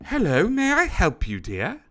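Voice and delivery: high, swooping genteel greeting; Mister Fancy Pants. Acted. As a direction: play a fussy, fancy elephant gentleman. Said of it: "Mister Fancy Pants looks at you and goes".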